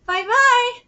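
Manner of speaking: high-pitched goodbye; cheery farewell. Acted. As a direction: call it out high as a character leaving. Acted farewell in dialogue.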